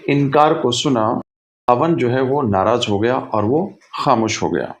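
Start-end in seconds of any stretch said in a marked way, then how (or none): none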